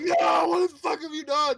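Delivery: high, loud shocked yell; mock Jill yell. Retelling Jill's reaction to a friend, shocked shout. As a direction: scream it at him in disbelief. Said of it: "She's like".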